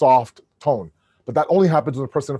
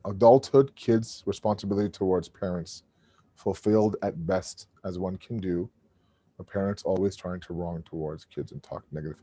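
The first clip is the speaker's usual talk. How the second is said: lower, softer reread, as if thinking aloud; reading to himself; 6 semitones lower, 6 dB quieter than usual. Real, not acted. Host rereads an audience question to make sense of it, low and quiet. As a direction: puzzle over the wording under your breath.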